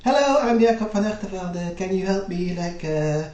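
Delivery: high, quick voice of a hopeful merchant at the door; eager visitor. Retold or imitated. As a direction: pitch it way up, a nervous eager plea. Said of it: "knocked on the door".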